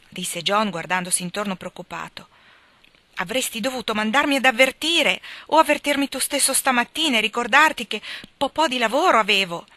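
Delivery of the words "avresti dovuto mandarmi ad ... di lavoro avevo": higher, louder scolding; angry wife. A narrator's reading. Meg scolds John angrily for the surprise guest. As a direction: scold him for not warning her. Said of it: "continuò Meg arrabbiata".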